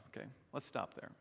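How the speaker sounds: usual speaking voice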